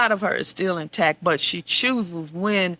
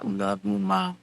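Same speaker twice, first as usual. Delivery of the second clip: tender; 4 dB quieter than usual. Real, not acted. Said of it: "I said".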